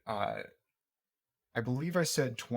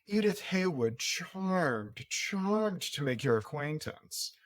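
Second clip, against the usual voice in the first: higher, slow, gracious lady voice; grand society hostess; 4 semitones higher, much slower than usual. Acted. GM voicing Edith introducing herself to the guests, higher and slow. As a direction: draw out charmed like a grand hostess. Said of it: "she comes up to you and says".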